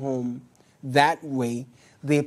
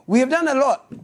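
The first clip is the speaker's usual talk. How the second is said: boastful politician; 8 semitones higher, 4 dB louder, faster than usual. Retold or imitated.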